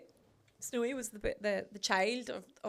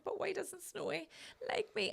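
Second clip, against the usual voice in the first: put-on whiny child voice; 9 semitones higher, 5 dB quieter than usual. Young girl whining to her mother about Snowy. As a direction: plead like a hurt little kid.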